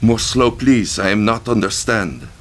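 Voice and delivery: put-on high, loud puzzled plea in French-Vietnamese accent. A narrator's reading. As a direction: ask him to slow down, accented. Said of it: "Her accent was a strange mixture of French and".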